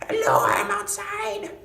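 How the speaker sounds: high, very loud drawn-out scream; mock scream. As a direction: scream it slowly down the phone in a thick accent. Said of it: "he just needs to scream".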